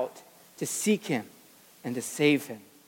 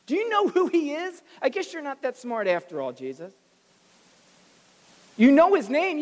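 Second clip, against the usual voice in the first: indignant crowd; 6 semitones higher than usual. Acted. Grumbling crowd scolds Jesus, indignant and higher. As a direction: throw the accusation like a scandalized bystander.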